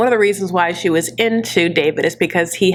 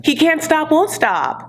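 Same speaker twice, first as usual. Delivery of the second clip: ordinary delivery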